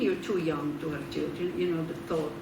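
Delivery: normal talking voice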